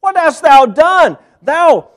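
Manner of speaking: loud, high indignant demand; with attitude. Acted. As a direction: confront him, outraged. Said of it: "I'm gonna say it with attitude".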